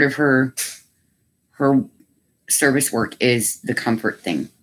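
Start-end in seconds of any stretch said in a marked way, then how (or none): none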